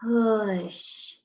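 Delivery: "Push" stretched out, long vowel sliding into sh; very slow sounding-out. Shown as a demonstration. Teacher showing writers how to hear each sound, slowly stretched. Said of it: "I have to say it so, so slowly".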